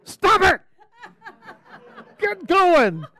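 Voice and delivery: high-pitched yell, breaking into laughter; mock yell. Teacher to straggling souls on the way up, yelling. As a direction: yell it from above to jolt someone moving, amused. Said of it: "And I yell".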